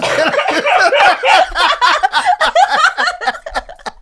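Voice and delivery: long high laughing fit; loud high laugh. Real, not acted. Laughing, being teased.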